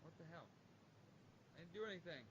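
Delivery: usual speaking voice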